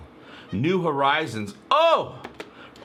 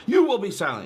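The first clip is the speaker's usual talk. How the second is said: higher, louder furious order; angry command; 9 semitones higher, 8 dB louder than usual. Retold or imitated. Bortus silencing a crewmate who went too far, angry. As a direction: slam the line down to end the argument. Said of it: "So Bortus is angry".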